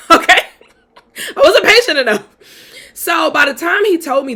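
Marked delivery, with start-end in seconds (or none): 0.1-2.3 high, loud laughing voice; real laughter breaking through the words; laughing admission. Real, not acted. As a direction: laugh at yourself as you insist. Laughs at own past impatience.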